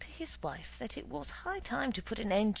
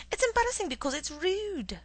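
indignant; 11 semitones higher, 5 dB louder than usual. Acted. Wife indignant at husband.

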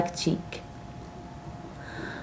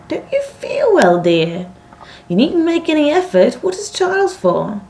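high-pitched, fussing protest; protesting daughters; 6 semitones higher than usual. A narrator's reading. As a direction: plead with your father in a higher voice. Daughters protest father's refusal.

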